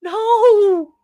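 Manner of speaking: loud high wail of no; dismayed cry. Real, not acted. Streamer learning the timeout length, loud wail. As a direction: let the no burst out. Realises timeout is thirty minutes.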